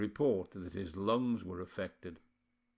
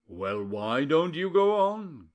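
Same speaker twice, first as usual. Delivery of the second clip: cried out; 5 semitones higher, 7 dB louder, slower than usual. A narrator's reading. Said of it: "cried Paolo".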